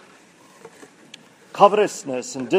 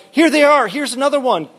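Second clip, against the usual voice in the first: high, loud dismayed wail; high loud wail of disgust; 10 semitones higher, 3 dB louder than usual. Acted. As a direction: wail at each flea she finds. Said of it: "Corrie wailed".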